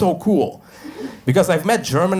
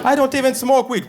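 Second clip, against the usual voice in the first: much higher, squeaky protest; mock panicked protest; 11 semitones higher than usual. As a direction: plead innocence, high and hammed-up. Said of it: "like Russian cops".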